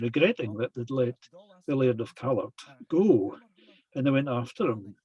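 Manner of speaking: ordinary delivery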